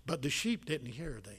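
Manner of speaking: hushed, unvoiced whisper of the verse; whispered scripture. Real, not acted. Preacher whispering the verse to his congregation, whispered. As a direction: drop to a secret whisper.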